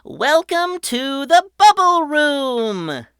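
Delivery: high, loud and slow showman voice; grand welcome. A narrator's reading. Patrick presenting the bubble room, slow and grand. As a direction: draw it out like a ringmaster. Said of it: "Patrick said".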